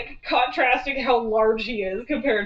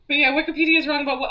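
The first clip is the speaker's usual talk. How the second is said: rattled off very fast and louder; fast retake; 3 semitones higher, 5 dB louder, much faster than usual. Real, not acted. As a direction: rush through the question. Repeating chat question.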